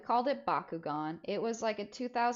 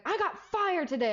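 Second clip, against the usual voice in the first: much higher, exasperated; mom's blunt news; 10 semitones higher, 3 dB louder than usual. Retold or imitated. Mom telling kids her news, higher exasperated. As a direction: blurt out the bad news. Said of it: "And she's like".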